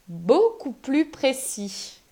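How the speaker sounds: slow, each word carefully articulated; deliberately slowing down to get it out; slow careful retry. Real, not acted. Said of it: "je vais y arriver".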